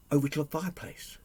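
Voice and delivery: plain reading voice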